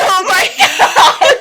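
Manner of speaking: high, loud, drawn-out laughing cry; real helpless laughing outburst; hysterical laughter. Real, not acted. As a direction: shriek it while cracking up. Reacts in hysterics to reminder.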